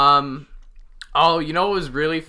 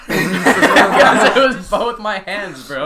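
slow, loud laughing protest; 2 semitones higher, 6 dB louder, much slower than usual. Real, not acted. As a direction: laugh it out defensively. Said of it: "defending himself, laughing".

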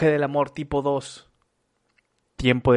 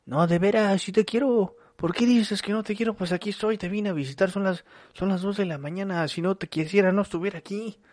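higher, half-pleading lover's voice; 8 semitones higher than usual. Acted. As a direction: beg like a hurt partner at two a.m. Acting a lover pleading softly.

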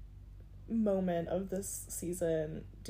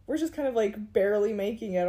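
emphatic; 3 semitones higher, 8 dB louder than usual. Real, not acted. Joking podcast slogan.

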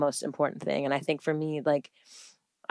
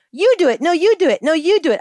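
put-on high, loud little-kid protest; imitating toddler's demand; 12 semitones higher, 8 dB louder, faster than usual. Mom quoting her son who wants independence, mock toddler insistence. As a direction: insist repeatedly like a determined toddler. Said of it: "So he goes".

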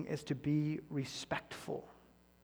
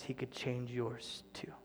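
softer, slow whisper; whispered appeal; 3 semitones lower, 10 dB quieter, slower than usual. Real, not acted. Pastor appealing to listeners, whispered. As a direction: lean in and whisper the promise.

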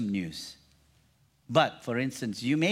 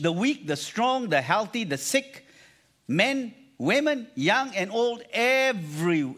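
ordinary talk, nothing marked

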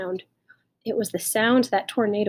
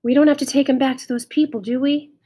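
quick, higher, louder plea; anxious pleading; 5 semitones higher, 5 dB louder than usual. A narrator's reading. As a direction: plead fast to keep him. Child pleads to keep the dog.

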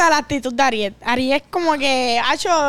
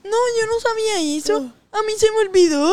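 playing dumb imitation; 7 semitones higher than usual. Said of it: "No, pero".

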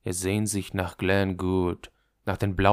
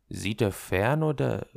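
plain reading voice

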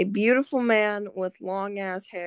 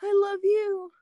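high, loving declaration; swooning; 10 semitones higher, 4 dB louder than usual. Acted. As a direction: pour emotion into it. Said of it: "as much emotion I can put out".

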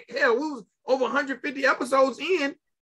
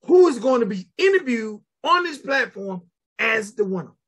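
ordinary talk

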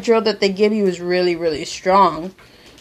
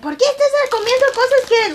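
angry mom. Retold or imitated. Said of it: "my mom got angry at me".

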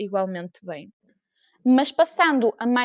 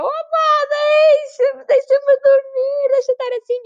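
much higher, louder whining plea; mock whiny plea; 7 dB louder, slower than usual. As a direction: whine to be left alone like a sulky teen. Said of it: "E eu". (queixa aguda e chorosa de adolescente)

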